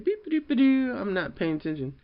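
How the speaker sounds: very high, ditzy robot beeps; oblivious kid. Retold or imitated. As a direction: play a zoned-out kid.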